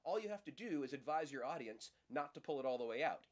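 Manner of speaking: ordinary talk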